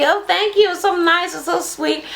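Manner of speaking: high, gushing thank-you voice; mock grateful guests. Twins mimicking happy guests getting gifts, high gushing. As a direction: gush like a delighted guest. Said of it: "Juicy was giving out gifts".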